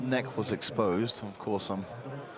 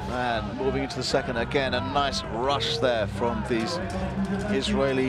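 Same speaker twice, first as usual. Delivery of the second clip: loud, excited fight commentary; live play-by-play; 5 semitones higher, 6 dB louder than usual. Real, not acted. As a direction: call the action as it happens.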